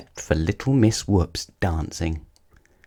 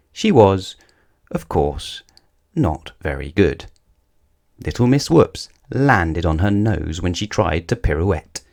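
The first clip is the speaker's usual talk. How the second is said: ordinary delivery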